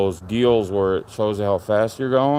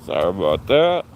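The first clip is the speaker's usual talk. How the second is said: ordinary talk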